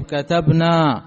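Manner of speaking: كتبنا said slowly and loud, final نا drawn out; stretched, loud demo. Shown as a demonstration. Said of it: "النون حركناها بالفتح". (كتبنا ببطء وبصوت عالٍ مع مدّ النون)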